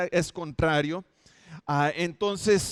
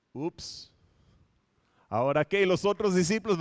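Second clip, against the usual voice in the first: normal talking voice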